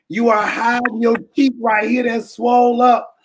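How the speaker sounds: usual speaking voice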